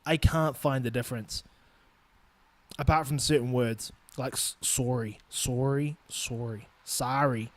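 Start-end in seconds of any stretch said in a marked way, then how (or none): 4.6-6.7 "sorry" with a rounded "SORE-ee" vowel; "sorry" with a long "or" in the first syllable; Canadian "sorry". Shown as a demonstration. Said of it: "Canadians, sorry". 6.8-7.5 "sorry" with an open "SAH-ree" vowel; "sorry" with an unrounded "ah" in the first syllable; American "sorry". Shown as a demonstration. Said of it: "Americans, sorry".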